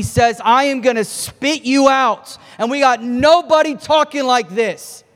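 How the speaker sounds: preaching at full force. Acted.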